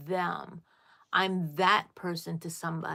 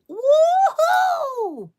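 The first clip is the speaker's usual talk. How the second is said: loud, high cheer; joyful whoop; 12 dB louder than usual. Real, not acted. Telling viewers about her first YouTube check, a loud joyful whoop. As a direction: whoop with delight at the news.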